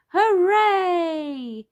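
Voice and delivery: high, loud, drawn-out hooray; cheering. A narrator's reading. Peppa running to the seesaw, excited cheer. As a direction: let the cheer ring out high. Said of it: "cheered Peppa".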